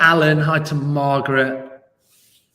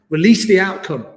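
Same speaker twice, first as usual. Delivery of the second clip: ordinary talk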